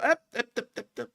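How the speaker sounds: high, laughing, cut-off stammer; flustered stammer. Retold or imitated. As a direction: sputter it out, half laughing. Officer stammering as he's cut off.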